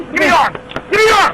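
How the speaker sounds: shouted command. Real, not acted. Officer yells at resisting man, loud command. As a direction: shout it at him mid-struggle. Officer forcing arrest, struggling.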